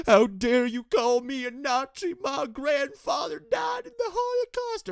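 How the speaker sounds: high mock wail rising into a shout; mock victim outrage. Vlogger mocking Ben playing the victim card, hammed-up wail. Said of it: "and be like".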